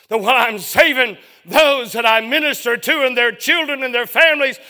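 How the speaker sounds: loud, high, anguished sermon cry; a father's desperate prayer, shouted; impassioned preaching. Acted. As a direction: wail it to the rafters in grief. Preacher cries out the grief.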